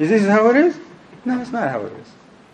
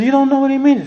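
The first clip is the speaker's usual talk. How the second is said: high-pitched imitated disbelief; incredulous; 9 semitones higher, faster than usual. Brother reacting to a preacher who cannot answer, incredulous. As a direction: question it as if astonished.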